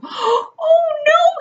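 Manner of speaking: very high, loud oh no with a gasp; frightened squeal. A narrator's reading. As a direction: gasp then squeal like scared piglets. Pigs cry out.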